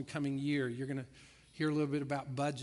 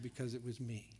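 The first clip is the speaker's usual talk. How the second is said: hushed reveal; 4 semitones lower, 8 dB quieter than usual. Acted. Quiet reveal of the story.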